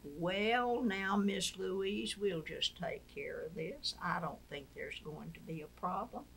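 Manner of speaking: slow, lightly voiced Southern gentleman; Southern drawl. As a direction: ease into a mild Southern senator's drawl. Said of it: "in his wonderful Southern drawl".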